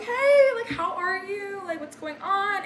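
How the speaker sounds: high, bright overexcited hello; bubbly greeting. Retold or imitated. Greeting Curtis at the bar with an overexcited high voice. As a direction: gush at him like an old friend.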